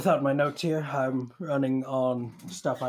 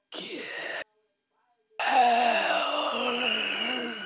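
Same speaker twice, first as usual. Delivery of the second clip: rasping, strained monster voice; raspy creature voice; 9 semitones higher than usual. Acted. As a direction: croak it out like a ghoul. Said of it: "there's this raspy voice".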